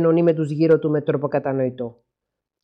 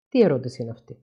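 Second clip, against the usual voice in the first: ordinary delivery, nothing marked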